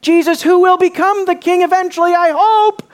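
high, loud, running qualification; mock hopeful plea, pitched high; hedging appeal. Acts out what beggar isn't saying.

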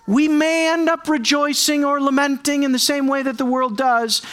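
ordinary delivery

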